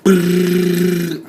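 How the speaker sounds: high, loud lip-trill drum roll. Acted. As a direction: buzz the lips like a drum roll. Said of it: "drum roll, please".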